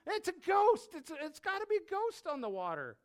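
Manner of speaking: very high, panicked cry; terrified scream. Acted. Disciples seeing Jesus on the water, screamed in terror. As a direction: shriek it out, then stammer on. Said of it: "they screamed out literally".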